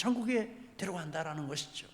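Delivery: usual speaking voice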